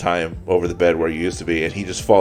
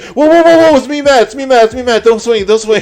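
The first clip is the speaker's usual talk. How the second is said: much higher, louder panicked yelps; panicked pleading; 7 dB louder than usual. Retold or imitated. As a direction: beg him not to swing, terrified. Friend panicking at swinging sword.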